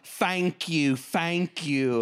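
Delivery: high Southern thank you; Southern accent. Pretend. Comic thanking the crowd, mock Southern accent. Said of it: "but in a Southern accent".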